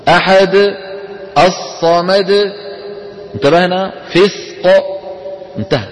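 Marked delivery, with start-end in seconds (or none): 0.1-2.3 أحد and الصمد each ending in one short crisp dal bounce; short correct qalqala. Shown as a demonstration. Teacher shows the correct short ending. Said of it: "ما في مط هون".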